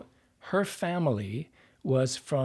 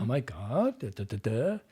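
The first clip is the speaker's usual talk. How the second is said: put-on Valley Girl upspeak, swinging up at the ends. Shown as a demonstration. Voice coach illustrates modern upspeak, Valley Girl lilt. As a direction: do a Valley Girl with rising phrase endings. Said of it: "it's like the Valley Girl thing".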